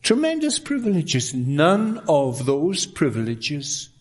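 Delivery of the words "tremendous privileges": much higher burst of emphasis; emphatic exclamation. Acted. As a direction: lift it high with wonder. Preacher's exclaimed climax.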